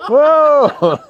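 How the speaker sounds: high loud laughing whoa; a giddy yelled whoa. Real, not acted. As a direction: whoop through a laugh. Laughing whoop.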